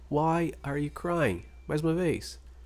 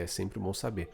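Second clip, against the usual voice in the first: ordinary talk, nothing marked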